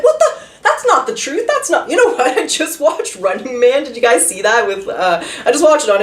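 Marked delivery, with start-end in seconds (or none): 0.0-1.9 much higher, shocked outrage at a lie; indignant disbelief. Retold or imitated. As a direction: squeal it in stunned disbelief, pitched way up. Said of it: "You're like".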